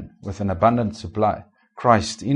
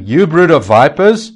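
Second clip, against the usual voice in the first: loud, higher, slow denunciation; fiery rebuke; 6 semitones higher, 10 dB louder than usual. Acted. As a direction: thunder it at the Pharisees. John rebuking Pharisees.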